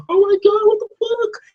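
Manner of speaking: very high, squealed shock; mock freak-out. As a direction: shriek it like you just saw the monster. Voicing own panic at the scene.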